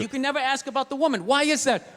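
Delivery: ordinary delivery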